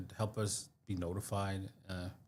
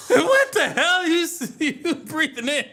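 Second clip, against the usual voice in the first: high laughing disbelief; laughing out the words; 9 dB louder than usual. Real, not acted. Laughing at partner's breathing.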